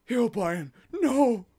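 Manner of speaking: high gasping mock surprise; hammed-up disbelief; playful fake astonishment; mock shock. Mock awe at Herobrine.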